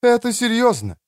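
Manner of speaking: high, insistent woman's voice; reproachful. Acted. Элина urges the doctor to be serious, high reproach. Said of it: "укоризненно проговорила Элина".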